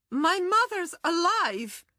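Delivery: high, desperate shout. Acted. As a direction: cry it out high and slow. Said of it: "Zora hears herself shout".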